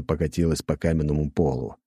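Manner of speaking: plain reading voice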